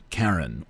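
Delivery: "Charon" said as KARR-ən; hard K pronunciation. Shown as a demonstration. Offering listeners a pronunciation choice, "Charon" as KARR-ən. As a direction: say "Charon" with a hard K, stress first. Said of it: "however you choose to pronounce it".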